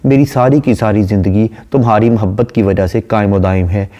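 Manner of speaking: usual speaking voice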